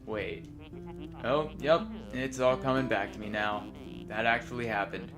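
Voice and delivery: softly reading dialogue lines aloud; reading game dialogue. Acted. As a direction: read the lines like a script. Reads both characters' dialogue.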